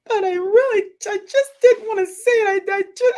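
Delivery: put-on high, loud tearful child's plea; crying child. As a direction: plead like a sobbing little girl. Toddler crying.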